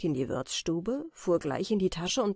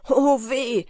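lauter, hoher Aufschrei vor Schmerz; Schmerzensschrei; 6 semitones higher, 10 dB louder than usual. Acted. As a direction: als ob die Hand brennt. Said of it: "schrie er und schüttelte die Hand". (laut gespielter Schmerzensschrei)

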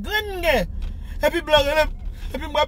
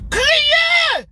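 high loud yelp; mock cry; 12 semitones higher, 5 dB louder than usual. Retelling how she cried, a loud mock wail. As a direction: belt out a short crying shout.

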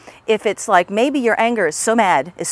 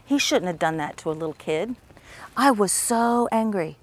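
focused on the feeling; 5 dB quieter than usual. Shown as a demonstration. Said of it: "focus on that emotion".

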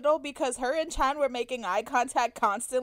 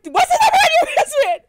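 shouted through laughter, much higher and louder; laughing shout; 14 semitones higher, 10 dB louder than usual. Retold or imitated. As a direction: yell it across the crowd, half laughing. Yelling at friend about wristband.

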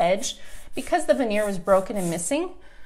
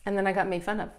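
ordinary talk, nothing marked

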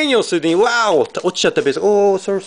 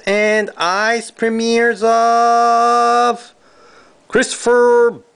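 high, long-held final word before the name; suspenseful announcer; 6 semitones higher, much slower than usual. Real, not acted. Card breaker teasing the next autograph, suspenseful announcer. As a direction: hold OF high and long like a drumroll.

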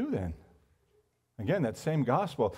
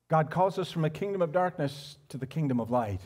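ordinary talk, nothing marked

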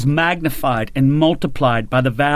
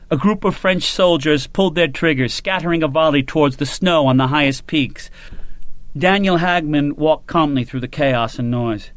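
ordinary delivery

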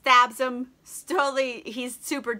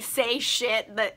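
usual speaking voice

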